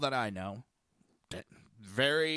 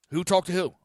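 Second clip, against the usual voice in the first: higher, louder challenge; mock interrogation; 5 semitones higher, 5 dB louder than usual. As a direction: fire the question at the accuser. Acted questioner challenges accuser.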